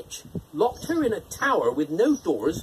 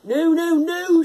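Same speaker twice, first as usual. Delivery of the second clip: higher, louder cry of horror; a father refusing to give up his baby; horrified shout; 10 semitones higher, 6 dB louder than usual. A narrator's reading. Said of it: "shouted the husband in horror".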